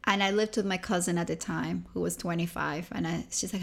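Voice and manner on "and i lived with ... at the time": ordinary talk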